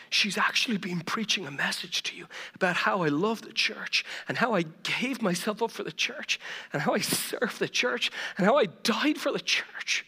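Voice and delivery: ordinary delivery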